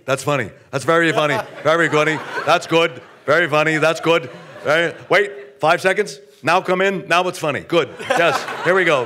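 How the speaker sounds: intellectualizing a joke out loud; Larry David. Retold or imitated. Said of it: "Larry David ... he recognizes humor".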